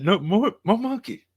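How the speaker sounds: high, loud delighted cheer; excited cheer. Pretend. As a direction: squeal it with glee. Echoing the monkey cheer.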